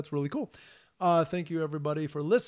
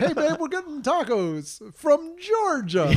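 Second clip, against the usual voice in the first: mock wife call; 10 semitones higher, slower than usual.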